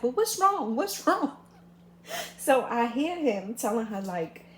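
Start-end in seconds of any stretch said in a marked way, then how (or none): 0.0-1.4 sister's worried questions pitched high; high voiced concerned sister. Sister at the door asking her upset sibling, high worried voice. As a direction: ask it up high like the anxious sister.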